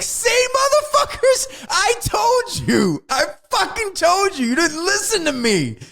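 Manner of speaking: very high, slow, shouted I-told-you-so; vindicated rant. Retold or imitated. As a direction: scream it like the ignored man finally proven right. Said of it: "I wanted Michael Biehn to be like".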